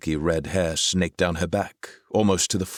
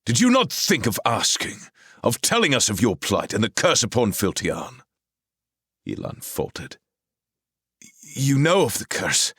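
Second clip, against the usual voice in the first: heated argument; 7 semitones higher, 3 dB louder than usual. A narrator's reading. Argument continues.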